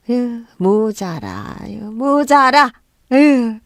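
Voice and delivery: long drawn-out disgusted reproach; scornful scolding. A narrator's reading. Mother-in-law belittles son's wife, disgusted. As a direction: stretch it out with contempt. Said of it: "저를 나무라셨어요".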